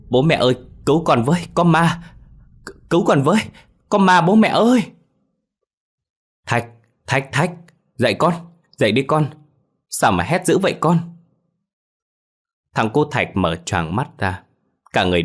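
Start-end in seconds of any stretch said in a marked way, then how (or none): 0.1-4.8 put-on child screaming for help, much higher and louder, fast; panicked shriek for mum and dad; a terrified boy yelling for his parents. A narrator's reading. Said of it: "hét lên một tiếng thất thanh". 6.5-11.0 mother calling her son awake, higher and louder; worried mother calling her boy out of a nightmare; urgent calling. A narrator's reading. Mother waking screaming child.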